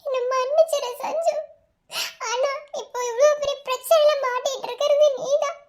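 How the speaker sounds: upset, voice thick with tears; tearful distress. Acted. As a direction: pour it out through gasping sobs. Distraught confession between tearful friends.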